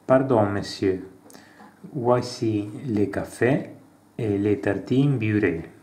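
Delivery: reading out a café dialogue with light character voices; acted dialogue reading. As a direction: play both sides of the café scene, lightly. Reads French café dialogue aloud. (lettura animata di un dialogo in francese)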